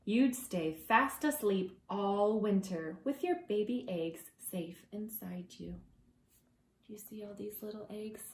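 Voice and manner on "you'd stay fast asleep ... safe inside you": ordinary talk, nothing marked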